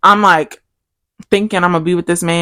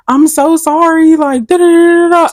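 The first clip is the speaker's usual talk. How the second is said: mock apology; 11 semitones higher, 6 dB louder than usual. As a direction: drag the sorry out like a pitiful ex. Ex voiced apologizing.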